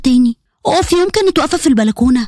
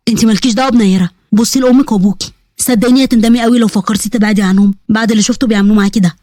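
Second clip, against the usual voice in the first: lower-pitched uncle figure; advising uncle; 5 semitones lower than usual. Acted. Uncle urging her not to leave her parents, advising. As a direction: counsel in a lowered older relative's voice.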